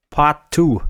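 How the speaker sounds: ordinary talk, nothing marked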